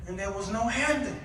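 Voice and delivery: usual speaking voice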